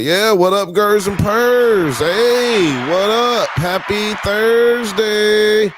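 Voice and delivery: loud, high hyped shout-out; loud and high, stretched out; hyped greeting. Real, not acted. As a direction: pump up the viewers.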